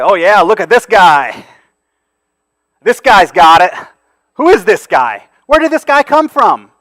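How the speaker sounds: loud, mocking "look at this guy"; derogatory mockery. Preacher imitating townspeople mocking Jesus, loud and sneering. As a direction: mimic the sneering crowd, loud. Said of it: "speaking to him derogatorily".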